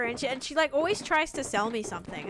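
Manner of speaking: ordinary talk, nothing marked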